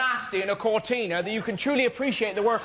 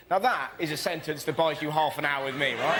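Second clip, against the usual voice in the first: ordinary talk, nothing marked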